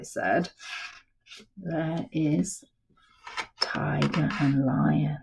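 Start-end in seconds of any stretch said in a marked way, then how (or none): none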